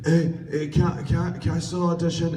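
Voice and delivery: put-on high, eager kid with stumbling start. Kid begging for a late audition, eager and high. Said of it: "And then this kid came up".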